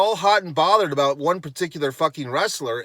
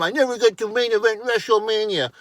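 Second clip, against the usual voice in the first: higher, whiny mock of his complaint; mocking CM Punk quote; 6 semitones higher than usual. Ranting host mocks CM Punk's complaint, high mock whine. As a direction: sneer his words back at him.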